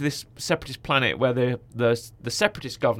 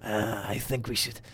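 breathy whisper; slower than usual. Retold or imitated. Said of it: "It's very breathy".

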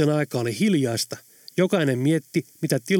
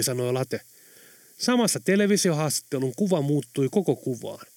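usual speaking voice

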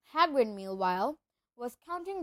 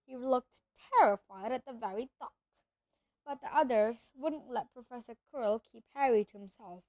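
plain reading voice

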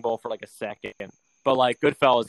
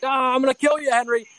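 mock threat; 11 semitones higher than usual. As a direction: threaten with a grin in a put-on voice. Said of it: "and he's like".

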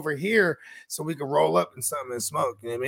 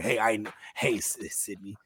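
low creepy whisper; 8 semitones lower, 7 dB quieter than usual. Retold or imitated. Quoting killer's greeting.

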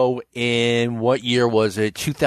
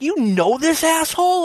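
high-pitched imitated disbelief; mock-incredulous outburst; incredulous girlfriend. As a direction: act out an appalled question, pitched way up. Girlfriend's incredulous question, acted.

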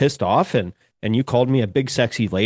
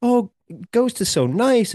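mocking fans; 10 semitones higher, slower than usual. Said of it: "everybody's like".

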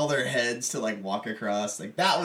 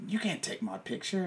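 retold Mick refusal; 9 semitones higher, 5 dB quieter than usual.

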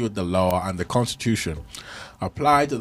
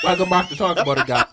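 fast, high host intro breaking into a laugh; hype intro, laughing; 7 semitones higher, 3 dB louder, faster than usual. Acted. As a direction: rush the show greeting through a laugh. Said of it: "this is how we're supposed to start the podcast".

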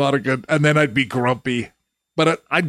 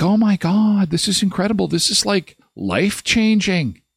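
mock gushing; 6 semitones higher than usual. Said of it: "And they're like".

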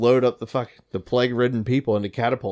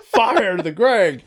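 slow, high mock battle order; drawn-out firing command through laughter; Dan Coates; 6 semitones higher, 3 dB louder, much slower than usual. Teller voicing Dan Coates launching Greg, mock firing command. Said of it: "he's like".

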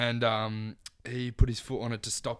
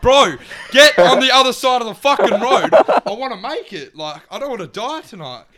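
panicked yelling; 9 semitones higher, 14 dB louder, faster than usual. Retold or imitated. Pleading with reckless driver.

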